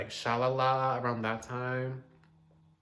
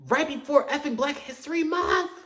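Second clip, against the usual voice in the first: high, indignant exclamation; outraged; 13 semitones higher, 3 dB louder than usual. Real, not acted. Complaining about the release timing, high and outraged.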